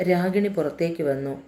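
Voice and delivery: usual speaking voice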